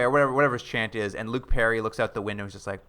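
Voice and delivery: usual speaking voice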